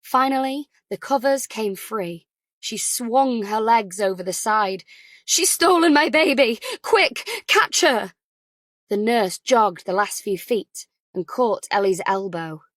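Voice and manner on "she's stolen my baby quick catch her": loud, high desperate plea; frantic shout. Acted. Ellie shouting to the nurse that her baby was stolen, frantic. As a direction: yell at the nurse to catch her.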